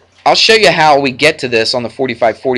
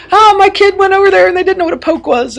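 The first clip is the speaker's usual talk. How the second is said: much higher, squeaky mock parent telling everyone; 3 dB louder than usual. Parent telling the reunion about the kid, hammed-up high mock voice. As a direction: ham up the gossiping parent, way up high.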